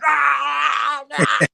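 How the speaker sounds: loud hammed-up roar; mock Goldberg yell. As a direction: yell like the wrestler from the table. Jumping on table, Goldberg yell.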